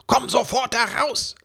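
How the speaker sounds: high, loud furious command; shout. A narrator's reading. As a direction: order her out at the top of his voice. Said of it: "schrie er".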